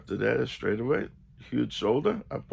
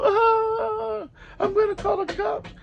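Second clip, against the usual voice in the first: mock shriek, very high and louder; screaming like a little girl; 5 dB louder, slower than usual. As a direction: imitate a panicking man squealing. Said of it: "screaming like a little girl".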